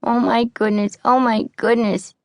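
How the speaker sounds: whining. A narrator's reading. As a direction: keep fretting, frightened. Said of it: "whined Tessie".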